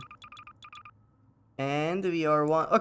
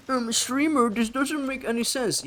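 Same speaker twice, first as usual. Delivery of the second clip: high, whiny mock viewer; mock chat voice; 10 semitones higher than usual. Streamer mocking a chat viewer, high whiny voice. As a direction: whine it like a nitpicking viewer.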